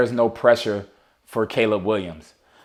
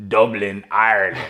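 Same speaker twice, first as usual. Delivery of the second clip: put-on Irish accent; 3 semitones lower than usual. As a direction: lay on a mild Irish lilt. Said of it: "you like my Irish accent?".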